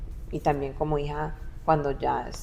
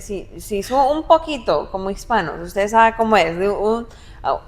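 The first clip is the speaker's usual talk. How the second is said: lively, higher and louder self-defense; raised voice; 5 semitones higher, 7 dB louder than usual. Retold or imitated. Daughter defends her raised voice against husband's complaint, raised voice. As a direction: imitate your own animated family chatter, voice raised. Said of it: "mi esposo dice que yo grito".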